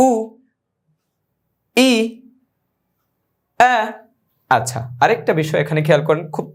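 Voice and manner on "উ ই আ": উ, ই, আ each drawn out and spaced apart; vowel demo. Shown as a demonstration. Teacher drilling hamza vowels for students, slow clear syllables. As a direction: sound out উ then ই then আ like reading drills. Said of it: "পড়তে হবে".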